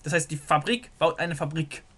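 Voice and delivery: usual speaking voice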